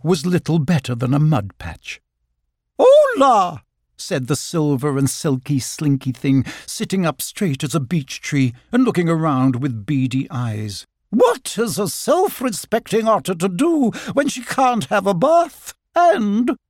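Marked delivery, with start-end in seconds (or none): 2.8-3.6 high, loud, grand greeting; haughty otter. A narrator's reading. Otter announcing herself out of the mud patch, haughty. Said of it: "she added in a haughty voice". 11.1-16.6 high-pitched, self-important lady otter; haughty otter. A narrator's reading. Otter complaining that she has no bath, haughty. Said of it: "she added in a haughty voice".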